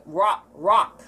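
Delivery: "rock, rock" with the R dropped; silent R. Shown as a demonstration. Showing her silent R in rock. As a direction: say "rock" with a weak R. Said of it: "the R is, like, silent as well".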